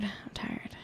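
breathless whispered I'm tired. Shown as a demonstration. Telling her friend she's tired, whispered. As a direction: let "I'm tired" fade to a bare whisper. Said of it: "repeating I'm tired fading to whisper".

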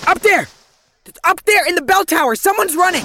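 high, loud call-out; urgent shout. Acted. Detective shouting to her partners about the tower. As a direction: shout to point out the shooter. Spotting shooter in bell tower.

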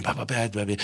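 hushed chatter under the show; real whispering. Retold or imitated. Friend chatting quietly in the theater, hushed. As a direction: whisper so the crowd can't hear. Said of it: "I'd be, like, real whispering".

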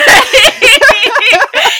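loud squealing laugh; high shriek of laughter. Real, not acted. Laughing at the joke.

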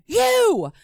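high-pitched mock yell; imitated shout. Warden shouting from across the room, imitated shout. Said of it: "I hear it again".

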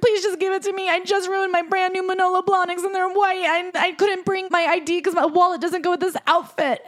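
high-pitched whiny begging voice; mock pleading. Host voices the writer begging a liquor store clerk, mock pleading.

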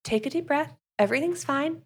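lightly voiced soothing boyfriend; Jared soothing Sam. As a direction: coax her gently like a nervous partner. Voicing Jared calming Sam down.

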